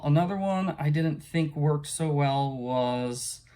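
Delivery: ordinary delivery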